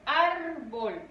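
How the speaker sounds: "árbol" in two syllables, first one stretched; stressed first syllable. Shown as a demonstration. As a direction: lean hard on the ÁR of "árbol". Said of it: "recae ahí la mayor fuerza de voz".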